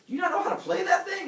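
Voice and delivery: high, loud, rapid question; comic outburst of disbelief, high and fast; incredulous demand. Retold or imitated. Teller as townsfolk questioning Heracles, loud incredulity.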